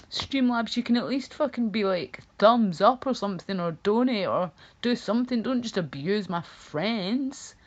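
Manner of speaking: half-joking rant at the bot; mock scolding. Real, not acted. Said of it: "scolding the Streamlabs bot".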